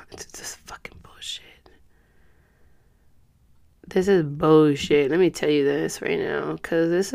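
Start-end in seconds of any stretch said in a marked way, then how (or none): 0.0-1.7 fed-up sigh, then a whispered curse. Real, not acted. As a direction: curse under your breath after a sigh. Frustrated aside, kept hushed.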